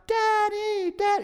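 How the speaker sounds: put-on high-pitched child calling out; mock children's greeting, very high; imitated excited kids. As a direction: squeal for Dad like a running kid. Kids run out calling him.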